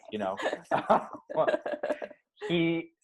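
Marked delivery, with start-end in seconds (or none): 0.4-2.1 breathy giggling laugh; high airy laugh. Real, not acted. Laughing at her dad impression.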